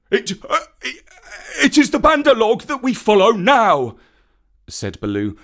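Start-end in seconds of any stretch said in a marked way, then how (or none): 0.1-4.0 words stuck in throat. A narrator's reading. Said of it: "the words stuck in his throat".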